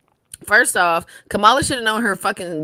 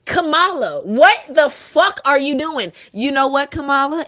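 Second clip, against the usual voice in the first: exasperated call; 5 semitones higher, 4 dB louder than usual. Real, not acted. Addressing Kamala in exasperation.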